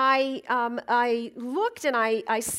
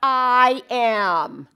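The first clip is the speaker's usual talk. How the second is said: loud, high, emphatic sermon opening; ringing pulpit delivery; preaching; 6 semitones higher, 7 dB louder than usual. Acted. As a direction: proclaim it to the room.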